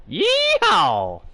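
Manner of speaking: loud high cowboy whoop; cowboy yeehaw. Pretend. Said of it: "Sound like a cowboy".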